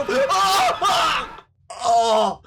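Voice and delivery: sudden high yelp of fright; startled scream. Real, not acted. Streamer scared by the grandma, startled scream. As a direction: jump out of your skin.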